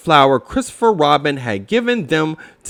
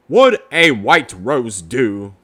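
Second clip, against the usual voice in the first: lightly voiced old owl, a bit lower; a hint of a wise old bird; Owl's voice; 4 semitones lower, 3 dB louder than usual. Owl offering Pooh help, lightly voiced old owl.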